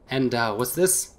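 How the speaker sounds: usual speaking voice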